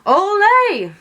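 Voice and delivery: high, loud cheer; a big cheer; cheering shout. A narrator's reading. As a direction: cheer like at a bullfight. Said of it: "shouted Cook".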